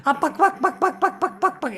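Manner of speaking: chicken clucking. Retold or imitated. People mocking you like chickens.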